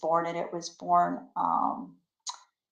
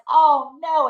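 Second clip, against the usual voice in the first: alarmed; 7 semitones higher, 8 dB louder than usual. Retold or imitated. Alpacas escaping.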